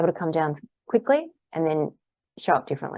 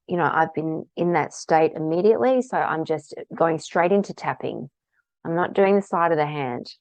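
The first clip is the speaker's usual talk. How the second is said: ordinary talk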